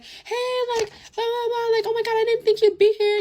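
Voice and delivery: very high-pitched gushing girl impression; mock girly greeting. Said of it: "you know how bitches be".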